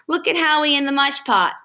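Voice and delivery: a touch of crowing glee. A narrator's reading. Ramona teasing Howie in the mush pot, a touch of crowing. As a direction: crow it, lightly teasing. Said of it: "crowed Ramona".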